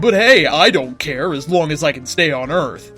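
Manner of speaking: plain reading voice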